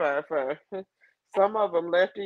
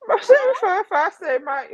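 mock rapture; 12 semitones higher, 5 dB louder than usual. Mock reading chat, eyes closed.

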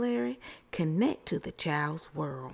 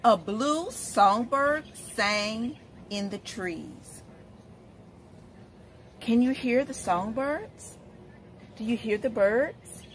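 put-on reading to children; 3 semitones higher than usual. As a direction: read it like story time on the rug. Reads picture book to children.

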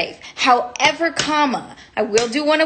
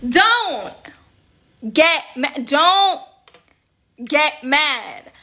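lightly high, breathy-free pop star voice; 3 semitones higher, much slower than usual. Retold or imitated. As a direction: give a small taste of a pop diva's coo. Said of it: "I will do my [name] impression".

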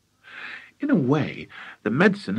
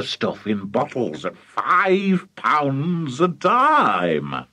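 grand, drawn-out enthusiasm; excited father; 3 semitones higher than usual. Acted. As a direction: stretch the big words with rising excitement. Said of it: "Mister Cranky was too excited".

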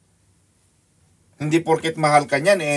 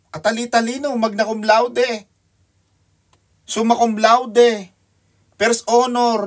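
mock grandeur; 7 semitones higher, 5 dB louder, slower than usual. Mocking the brilliant students' honors.

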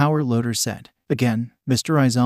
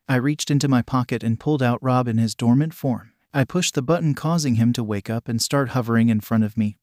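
plain reading voice

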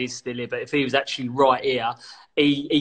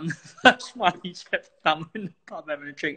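normal talking voice

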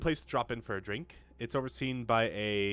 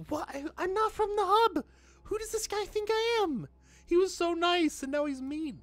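high, loud offended protest; outraged that the nice guard turned mean; indignant squeal; 6 dB louder than usual. Real, not acted. Player to the suddenly rude guard, squealing in outrage.

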